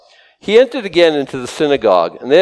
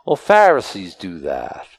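ordinary delivery

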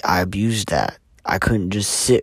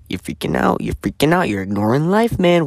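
much higher, pushing the alarm; rising panic; 7 semitones higher, 3 dB louder than usual. Retold or imitated. The racing inner voice telling him he's losing it, panicky and high. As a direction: let the panic climb up in pitch.

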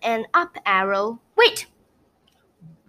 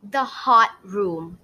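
usual speaking voice

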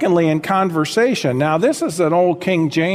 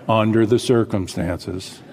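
gloomy mope; 7 semitones lower than usual. Acted. Continues gloomy Eeyore reply.

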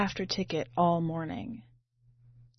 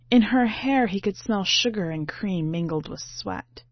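usual speaking voice

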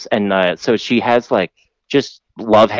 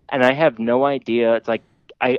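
ordinary talk, nothing marked

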